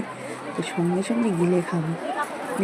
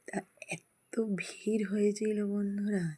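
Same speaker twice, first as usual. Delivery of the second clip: hushed emphasis; 8 dB quieter, slower than usual. Real, not acted. Stressing how big the crowd was.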